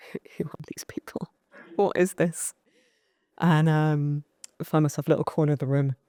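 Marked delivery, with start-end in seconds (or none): 0.1-2.5 hushed, baffled whisper; bewildered whisper. Retold or imitated. Newcomer quietly baffled by the room, hushed whisper. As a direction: whisper in disbelief at the strangers. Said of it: "I'm like".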